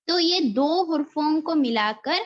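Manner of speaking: ordinary talk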